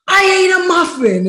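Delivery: panicked yell. Retold or imitated. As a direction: blurt it out in a panic. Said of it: "And I said".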